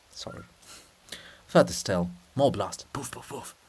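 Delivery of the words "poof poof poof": soft faraway explosion sounds; muffled distant poofs. Acted. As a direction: quietly mimic far-off blasts. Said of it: "But muffled. Far off".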